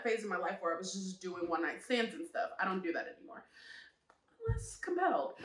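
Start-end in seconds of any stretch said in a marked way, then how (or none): none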